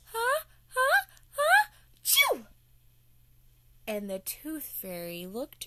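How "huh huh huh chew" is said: rising huh huh huh then a loud chew; building sneeze. Acted. Olivia hiding in the house, sneeze builds up loud and high. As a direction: climb higher and louder into the sneeze. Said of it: "Olivia goes".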